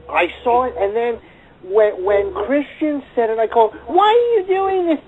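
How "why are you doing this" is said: very high-pitched mimicked whine; mocking high whine; whiny imitation. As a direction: copy his whining complaint, pitched way up. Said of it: "I'm doing it in the exact voice".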